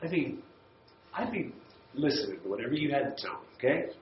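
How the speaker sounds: ordinary delivery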